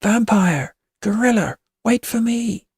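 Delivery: high calls to friends far off; calling out. A narrator's reading. Witch calls the boys to wait, calling. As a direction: call across a field, stretched out. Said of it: "Witch calls".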